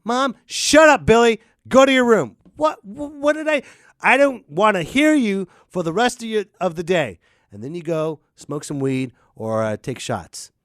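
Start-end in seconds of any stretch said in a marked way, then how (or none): 0.0-2.4 put-on loud high snapping at the kid; angry parent. Mom snaps at Billy and sends him off, shouting. 2.6-7.2 ranting parent. Acted.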